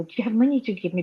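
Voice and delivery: fast, teasing question; teasing the caller. Retold or imitated. To a charity caller, fast and teasing. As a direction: rattle it off to mess with the caller. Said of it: "And I just go".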